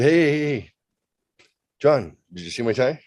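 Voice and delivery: mock eager call. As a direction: flag him down eagerly. Calling after the president.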